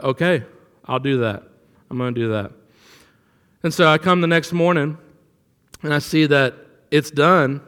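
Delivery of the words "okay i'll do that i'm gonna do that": low, half-hearted mock agreement; lightly voiced slacker, low and soft; lazy employee. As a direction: agree with no real commitment. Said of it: "my lazier employee".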